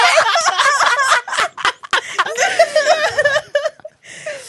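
high, loud burst of laughter; a mortified cackle at being caught; real, helpless laughter, high and loud; loud embarrassed laugh. Real, not acted. Caught out by mom's rule.

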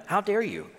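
high-pitched mild offence; mock indignation. Preacher voices Pharisees mad at Jesus, mock indignant. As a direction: act affronted, without shouting. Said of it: "They're mad at him".

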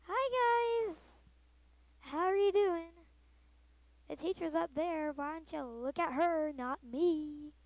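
high, loud, mock cheerful greeting; awkward kid. Me to classmates staring at me, awkward mock cheer. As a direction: brush it off with fake cheer. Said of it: "I'm like".

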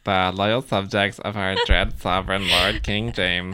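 lightly voiced old-timey proclamation. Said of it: "Can you do it in, like, a old-timey voice".

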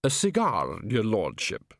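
lightly voiced servant. A narrator's reading. Servant offers cigar.

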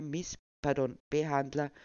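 usual speaking voice

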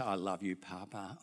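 tender bedtime murmur; soft sleepy child voice; sleepy grandchild. Retold or imitated.

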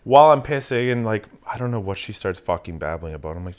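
usual speaking voice

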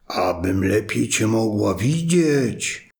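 slow, low, sly answer; wolf as grandma. Acted. Wolf in grandma's bed answering the girl, in a slow sly voice. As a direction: draw it out, hiding the wolf.

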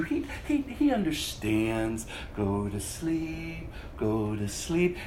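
the devil soothing the church; satanic lullaby. Pretend. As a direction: lull them to sleep, sinister. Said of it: "a satanic lullaby being sung".

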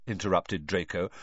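ordinary delivery, nothing marked

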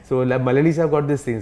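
usual speaking voice

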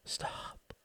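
a barely audible whisper; repeated whisper. A narrator's reading. Ruth repeating her warning to Tom, whispered. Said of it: "she repeated".

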